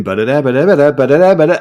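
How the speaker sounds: rattled-off brass stabs, climbing in pitch and level; mouthed horn-section noise; imitated brass riff. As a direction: scat the horn section's crashing entry.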